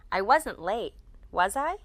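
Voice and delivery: checking question with "was I" going up; upward intonation. Shown as a demonstration. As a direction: lift the pitch on the tag "was I". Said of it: "We use upward intonation".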